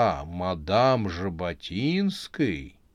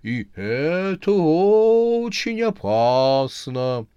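higher, louder, very slow eerie warning; sepulchral voice; 7 semitones higher, 6 dB louder, much slower than usual. Acted. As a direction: wail it like a ghostly oracle. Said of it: "за могильным голосом вещала".

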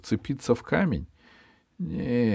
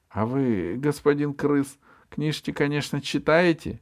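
squeaky M voice; 7 semitones higher than usual. A narrator's reading. M answers rat.

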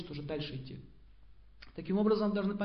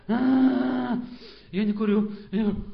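loud, drawn-out, straining; strained shout; 3 semitones higher, 9 dB louder, much slower than usual. Acted. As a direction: force the words out loud and slow. Acted straining against temptation.

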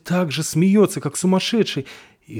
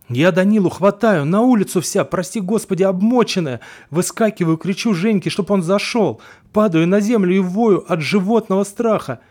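panicked retelling; 6 semitones higher, 4 dB louder than usual. Acted. Паника матери при бегстве.

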